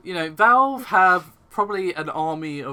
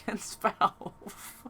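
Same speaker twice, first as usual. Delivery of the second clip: laughing; 5 semitones higher, 5 dB quieter, much slower than usual. Real, not acted. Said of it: "can't even say this without laughing".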